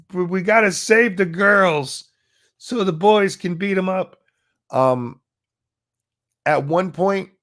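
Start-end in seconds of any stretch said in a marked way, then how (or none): 0.1-4.2 mock quote. Mocking [name]'s speech quote.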